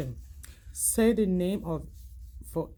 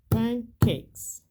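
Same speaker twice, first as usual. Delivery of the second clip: syllable-count demo; 5 semitones higher, 5 dB louder than usual. Shown as a demonstration. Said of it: "how many syllable do you hear".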